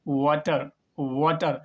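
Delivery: वाटर with rounded lips for a w, not a b; w sound. Shown as a demonstration. Teacher correcting pupils, not b: rounded w. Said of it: "ना कि बाटर".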